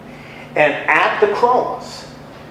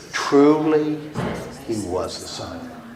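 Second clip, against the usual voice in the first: a hint of a hushed soldier, lower and softer; awed centurion; 7 semitones lower, 6 dB quieter than usual. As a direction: quietly state it in awe. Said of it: "what does the centurion say".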